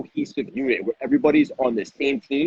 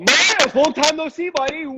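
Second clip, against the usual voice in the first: high, hyped-up party shout; rowdy party animal. Real, not acted. Hyped greeting to a kid.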